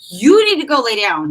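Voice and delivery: loud re-enacted scolding, pitch swooping up; retold yell. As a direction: imitate yourself yelling an order at him. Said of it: "I yelled at him".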